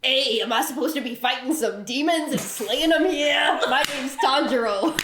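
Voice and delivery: comic New Jersey tough talk; Tanjiro with a Jersey accent. Acted. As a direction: ham up a brash Jersey accent. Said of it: "Tanjiro from Jersey?".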